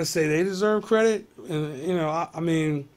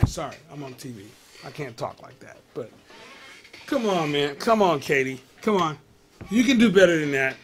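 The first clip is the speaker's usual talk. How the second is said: ordinary talk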